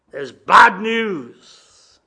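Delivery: "bad" hit loud and drawn out; very slow, loud weight on "bad"; emphatic warning. Real, not acted. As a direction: land "bad news" like a heavy blow. Preacher drives home the bad news.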